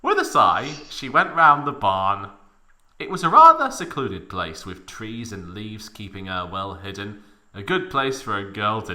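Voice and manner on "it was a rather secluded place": ordinary delivery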